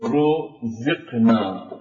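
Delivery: رزقنا said very slowly with a full, heavy ra; careful Quran recitation of رزقنا; heavy ra demo. Shown as a demonstration. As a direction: draw out رزقنا and thicken the ra. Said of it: "وفي رزقنا مضمومة، فلذلك تفخم".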